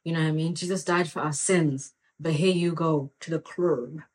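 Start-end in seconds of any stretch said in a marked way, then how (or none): none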